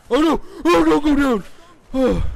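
high, loud alarm; panicked yell. Real, not acted. Gamer about to go down, panicked yell. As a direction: cry out in sudden panic. Panicking during zombie game.

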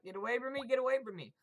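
high, loud frantic begging; panicked plea. Real, not acted. Gamer begging an attacker to back off, high panicked cries. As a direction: squeal it as you run for your life.

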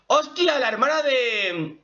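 high, startled burst; excited recognition. Real, not acted. Anime fan spotting a familiar character, excited. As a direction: blurt it out on a sudden discovery. Sudden recognition of a voice actor's role.

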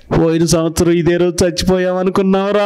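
hammed-up relatives' lament, stretched at the end; mock wailing. Preacher mimicking relatives gathered round the returned man, mock wailing. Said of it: "అని ఏడిచారు అనుకోండి".

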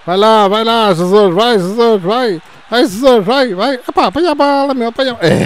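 high, loud urging; cheering shout. Real, not acted. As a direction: yell them on. Urging players. (incentivo aos gritos)

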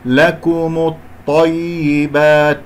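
لكم الطيبات slowly with heavy ta; slow recitation. Shown as a demonstration. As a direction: glide from mim to ta. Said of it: "انتقلت من الميم إلى الطاء".

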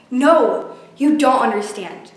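bitter, rising fury; angry outburst. Acted. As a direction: lash out in anger. Furious outburst at therapist.

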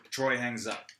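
ordinary talk, nothing marked

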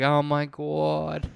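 very slow, drawn-out mock swoon; swooning women. Women melting at the skateboarder's line, slow mock swoon. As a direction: stretch it out like a melting admirer. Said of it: "They're like".

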